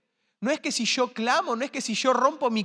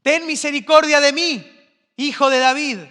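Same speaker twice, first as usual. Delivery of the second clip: loud pleading cry, pitched up; desperate shout; 6 semitones higher, 9 dB louder than usual. Retold or imitated. Woman crying out to Jesus for mercy, loud desperate shout. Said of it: "esta mujer empieza a gritar".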